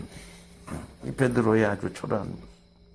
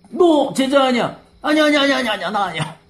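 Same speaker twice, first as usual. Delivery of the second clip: much higher, louder frantic denial, fading out; panicked denial; 12 semitones higher, 5 dB louder than usual. Acted. Peter frantically denying to the servants, much higher then fading. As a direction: deny it in a rising panic, then trail off.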